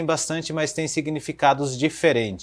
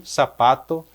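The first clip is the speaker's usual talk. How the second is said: ordinary talk, nothing marked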